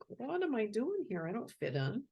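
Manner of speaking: a touch of a lost little sheep; lonely sheep. Acted. Reader voicing a sheep who doesn't fit in, mildly puzzled.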